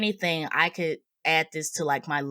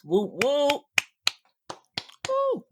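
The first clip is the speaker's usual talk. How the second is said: high whooping cheer; excited whoop; 9 semitones higher, slower than usual. Real, not acted. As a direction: whoop like hyping up a crowd. Hyping up the guest.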